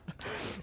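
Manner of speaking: breathy stifled laugh; whispered giggle. Real, not acted. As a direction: let out a hushed giggle. Whispered laugh after quote.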